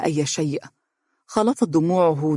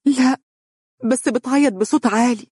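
through tears; 8 semitones higher than usual. Acted. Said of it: "قالت من بين دموعها".

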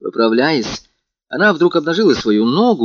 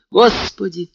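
short, higher-pitched startled exclamation; frightened cry; 7 semitones higher, slower than usual. A narrator's reading. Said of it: "Она вскрикнула от испуга". (испуганный вскрик)

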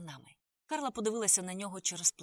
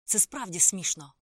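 exasperated; 9 dB louder, slower than usual. A narrator's reading. Narrator repeats exasperated.

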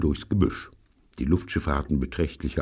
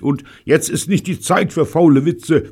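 higher, sharp gruff interruption; 12 semitones higher, 5 dB louder than usual. Acted. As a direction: cut him off gruffly. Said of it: "unterbrach Bunny barsch". (barsch unterbrechend)

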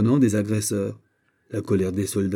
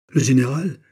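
higher, louder startled exclamation; startled cry; 4 semitones higher, 6 dB louder than usual. A narrator's reading. Officer recognises his general and drops his sword, startled cry. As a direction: cry it out in alarm. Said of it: "cria Digby en laissant tomber son épée".

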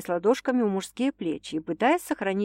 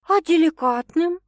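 high, louder dumbfounded question; stunned follow-up; 9 semitones higher, 5 dB louder than usual. A narrator's reading. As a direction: ask in shock. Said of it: "ошарашенно уточнила Саша".

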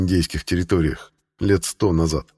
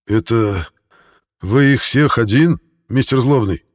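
higher, slow, halting stammer; slight stammer; 4 semitones higher, 4 dB louder than usual. A narrator's reading. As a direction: stumble over the words nervously. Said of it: "слегка заикаясь". (слегка заикаясь)

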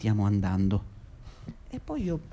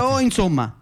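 exasperated outburst; 8 semitones higher than usual. Acted. Boss losing patience with Abdel, exasperated burst. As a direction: snap out of patience.

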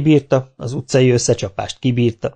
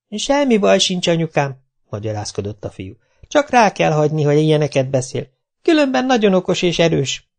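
put-on high, eager boy's voice; high boy soothing his mother; pleading boy; 6 semitones higher than usual. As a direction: talk mum round quickly.

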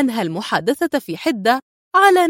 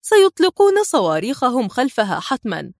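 ordinary delivery

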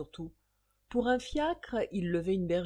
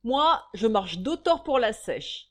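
pari lancé fort; 3 semitones higher, 7 dB louder than usual. Acted. Ragdal parie à voix forte.

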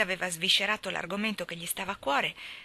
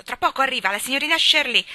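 fast, raised, loud warning; breathless urgency; 6 semitones higher, 9 dB louder, faster than usual. A narrator's reading. Geraldine warns Miss Shirley is coming, breathless hurry. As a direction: rush it out urgently. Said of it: "disse Geraldine senza fiato".

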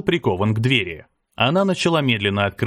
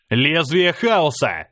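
drawn-out higher-pitched skill call; slow, ringing battle shout; battle cry; 4 semitones higher, much slower than usual. A narrator's reading. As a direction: call out the attack name slowly, pitched up. Hero calls out his skill.

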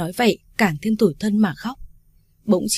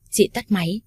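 ordinary delivery, nothing marked

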